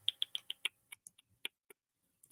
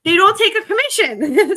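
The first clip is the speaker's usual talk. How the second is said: high, loud laughing announcement; excited reveal; 10 semitones higher, 8 dB louder than usual. Real, not acted. Announcing after drum roll.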